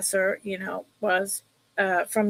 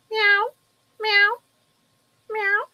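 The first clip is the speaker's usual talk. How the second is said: high mock cat meows; meowing; 11 semitones higher than usual. Meowing at Maggie the cat to coax her over. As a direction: squeak little meows like a kitten.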